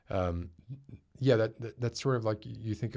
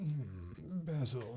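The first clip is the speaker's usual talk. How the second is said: a lightly put-on posh voice, higher and softer; a hint of upper-class posh, pitched up and softened; 7 semitones higher, 5 dB quieter, much slower than usual. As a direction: toss off the name like a snooty one-percenter. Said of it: "my attempt at a, uh, at a posh voice".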